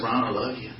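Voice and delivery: soft, gentle "Ron, I love you"; tender voice of God. Retold or imitated. God calling the preacher by name again, softly. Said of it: "And God said".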